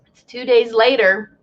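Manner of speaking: usual speaking voice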